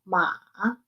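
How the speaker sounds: long MA falling then rising; third tone. Shown as a demonstration. Teacher giving word for horse, long dipping "ma". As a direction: draw "ma" out like a long question. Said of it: "en tercer tono".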